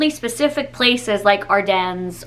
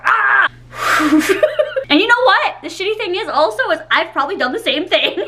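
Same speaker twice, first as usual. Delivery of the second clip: laughing outburst; 7 semitones higher than usual. Real, not acted.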